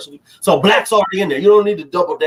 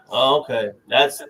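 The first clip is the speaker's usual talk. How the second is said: normal talking voice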